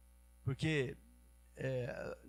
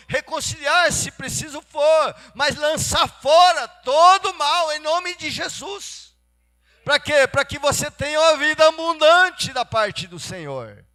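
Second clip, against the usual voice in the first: loud, high-pitched sermon delivery; impassioned preaching; 8 semitones higher, 10 dB louder than usual. Real, not acted. Preacher urging the congregation to cast out evil, fervent and loud. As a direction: drive it home with rising force.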